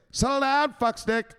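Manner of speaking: high, punchy enforcer voice; the Enforcer's line. Retold or imitated. Streamer voicing the Enforcer telling a viewer off. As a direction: bark it like a tough enforcer. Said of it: "he's gonna tell you one thing".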